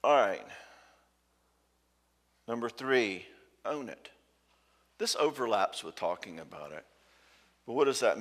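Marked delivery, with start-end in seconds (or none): none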